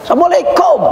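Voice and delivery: high, irritated shouted greeting; annoyed greeting. Retold or imitated. Husband ignored at the door, high irritated greeting. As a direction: bark the greeting after being ignored. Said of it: "akhirnya naik darah".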